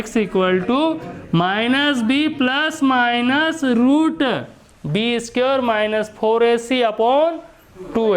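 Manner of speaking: very slow, stretched-out recital of the formula; measured teacherly chant, term by term; model formula reading. Shown as a demonstration. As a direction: draw out each term for students to memorise. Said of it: "ज़रा ऐसे पढ़ना, मैं बता रहा हूँ ऐसे".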